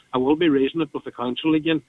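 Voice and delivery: usual speaking voice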